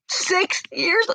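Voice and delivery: crying voice climbing in pitch; tearful, building. Real, not acted. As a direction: count through tears, rising to a high wail. Tearful count building to a wail.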